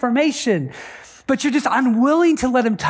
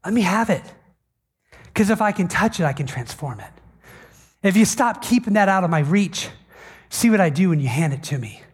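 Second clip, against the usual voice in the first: gently pleading Spirit; 4 semitones lower than usual. Acted. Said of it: "And the Spirit's like".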